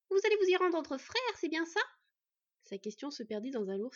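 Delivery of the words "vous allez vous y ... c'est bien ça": much higher, brighter asking; curious, a little intrusive; high questioning voice. A narrator's reading. Adversary asking the brothers, high and curious.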